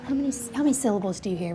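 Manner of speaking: normal talking voice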